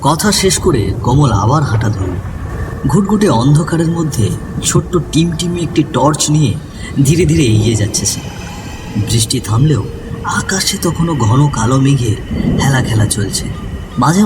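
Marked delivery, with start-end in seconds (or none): none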